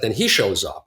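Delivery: ordinary delivery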